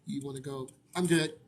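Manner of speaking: usual speaking voice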